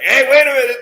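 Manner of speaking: high, loud, indignant "hey, wait a minute". Retold or imitated. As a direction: squawk it like an offended fan. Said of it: "tag me like".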